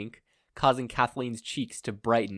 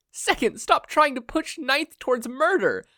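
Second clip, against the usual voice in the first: high, loud scolding; 7 dB louder than usual. A narrator's reading. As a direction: tell him off sharply. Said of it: "Nana scolded".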